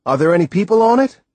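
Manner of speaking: fast, loud, rising question; eager question. Acted. Boy eagerly asks Timothy about the island, fast rising question. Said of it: "I repeated".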